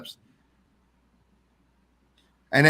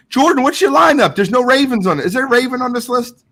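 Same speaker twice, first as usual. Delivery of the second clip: calling out; 7 semitones higher than usual. Real, not acted. Calling out to chat.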